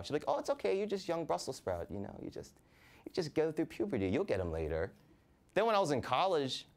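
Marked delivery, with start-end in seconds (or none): 0.3-4.9 high, soothing mom voice. Retold or imitated. Mother consoling her son about girlfriends, high cooing voice. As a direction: coo at him like a mother.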